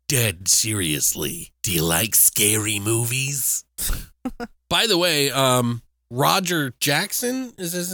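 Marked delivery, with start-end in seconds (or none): 0.1-3.6 slow, low menacing phone killer; Ghostface. Acted. Horror killer warns the audience against spoilers, slow menace. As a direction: lower it into a drawn-out horror-villain threat. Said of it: "clip of Ghostface warning the audience".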